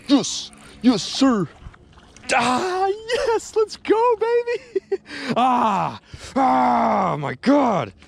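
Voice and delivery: high, loud triumphant whoops; ecstatic yelling. Real, not acted. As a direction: celebrate the catch at the top of your voice. Landing fish, ecstatic cries.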